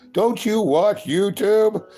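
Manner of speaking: put-on angry old American guy. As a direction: ham up a cranky old guy bragging. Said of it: "angry old American guy impression".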